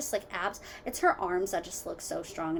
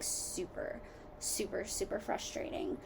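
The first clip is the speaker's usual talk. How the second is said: breathy whispered exasperation; frustrated whisper; 9 dB quieter than usual. Real, not acted. Venting to viewers about the SD card, frustrated whisper. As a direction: whisper it, worn out by the hassle.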